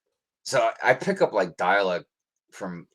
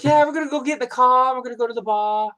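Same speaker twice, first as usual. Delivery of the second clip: high, loud mock Boston accent, dropped r's; imitating a Boston accent; 9 dB louder, faster than usual. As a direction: play the sister with a thick Boston accent. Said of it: "they definitely have a pretty thick accent".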